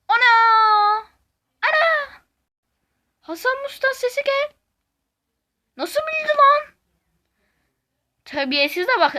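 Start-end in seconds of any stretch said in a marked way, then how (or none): 0.1-2.2 high, loud shocked cry; surprised shout. Real, not acted. Akinator'ün bulduğuna şaşkın bağırış. 3.2-6.7 surprised. Real, not acted. Doğru tahmine şaşırma.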